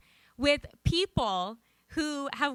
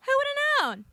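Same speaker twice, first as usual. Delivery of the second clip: very high, amazed grandmother voice; imitated grandmother; 13 semitones higher, 4 dB louder than usual. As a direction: squeal it in mock wonder. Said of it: "And she says".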